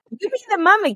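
put-on very high little-kid voice; squeaky-high mimicry of a small child; three-year-old child. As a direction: imitate a toddler handing out roles in a game. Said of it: "I have a three-year-old".

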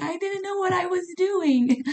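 answering her mentor, high and flustered; flustered protest. Retold or imitated. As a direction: squeak it out like a caught kid. Her flustered reply to Joe.

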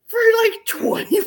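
high, loud laughing whine; hammed-up laughing lament; mock whining. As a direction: wail it like a dramatic complaint. Complaining about the challenge.

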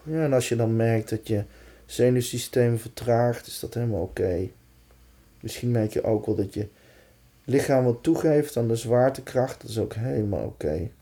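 calm, unhurried meditation voice; guided meditation. Acted. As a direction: gently lead them into relaxation. Guides a body relaxation exercise.

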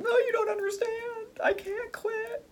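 hammed-up tearful wail; mock whiny cry. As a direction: whine it like you're near tears. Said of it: "And you're going".

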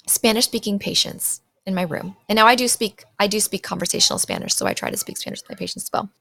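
ordinary talk

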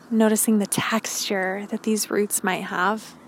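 calm grounding exercise. Acted. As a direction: guide them gently into stillness. Leads grounding meditation.

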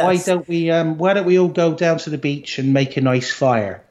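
put-on lightly done country Isle of Wight accent. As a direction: lilt it like an Isle of Wight local. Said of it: "from like".

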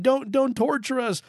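high, urgent plea; acted high-pitched begging; begging demons. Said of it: "they were begging".

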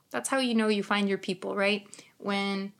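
normal talking voice

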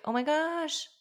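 high, cooing mock delight; put-on high-pitched awe; mock gushing recognition. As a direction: imitate a mother gushing over her newborn. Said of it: "instantly know who she was".

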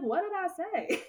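high-pitched, puzzled retort; retold clueless "who, me?" reaction; baffled question. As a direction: pitch jumps up in mock bewilderment. Retelling her baffled reply.

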